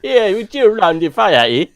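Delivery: put-on a jokey Canadian accent with a Jamaican lilt, ending on "eh". After the co-host hears Jamaican in it, a mock Canadian-Jamaican accent. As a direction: blend Canadian and Jamaican on purpose for laughs. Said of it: "Canadian mixed with Jamaican".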